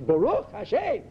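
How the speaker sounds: high-pitched Baruch Hashem, rising at the end; acted burst of enthusiasm, as if tipsy; exuberant. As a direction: exclaim it with over-the-top fervour. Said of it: "you drink something".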